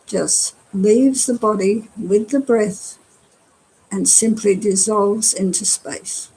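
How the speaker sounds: unhurried meditation guide with long pauses; guided meditation. Acted. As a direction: guide them gently through relaxing, phrase by phrase. Leads a body-scan meditation.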